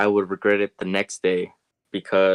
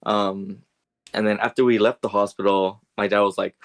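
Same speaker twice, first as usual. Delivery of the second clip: usual speaking voice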